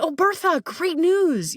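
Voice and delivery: high, eager greeting with good news; excited announcement. Retold or imitated. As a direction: pitch way up, bursting to tell. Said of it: "I'm like".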